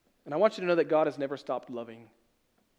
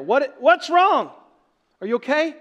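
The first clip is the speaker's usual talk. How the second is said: alarmed parent; 10 semitones higher, 10 dB louder than usual. Retold or imitated. Parent rushing to screaming child.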